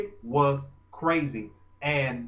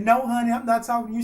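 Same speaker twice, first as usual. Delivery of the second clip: higher, louder, fast sweet reassurance; lightly voiced Brandy, higher and brisk; imitating Brandy; 9 semitones higher, 6 dB louder, faster than usual. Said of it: "She said".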